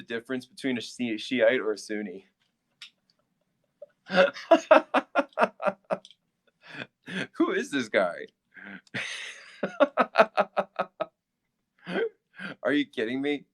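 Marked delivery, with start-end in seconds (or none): 4.1-6.0 long high giggle; high laughter. Real, not acted. Laughing hard at a viewer's chat question. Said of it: "I'm just laughing". 9.6-11.0 high breathy giggle; high laughter. Real, not acted. Still laughing at a comment calling him clueless. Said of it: "I'm just laughing".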